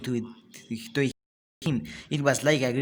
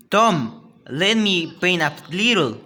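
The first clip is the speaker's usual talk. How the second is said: eager Ben; 5 semitones higher, 5 dB louder than usual. A narrator's reading. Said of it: "Then he said".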